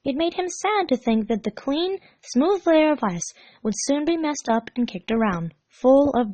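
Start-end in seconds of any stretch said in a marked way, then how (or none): none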